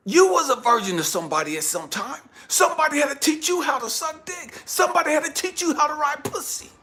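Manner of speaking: loud, high angry tirade; dad yelling at his daughter about sex; furious yelling. Retold or imitated. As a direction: shout her down.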